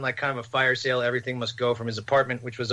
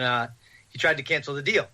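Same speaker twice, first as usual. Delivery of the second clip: ordinary delivery, nothing marked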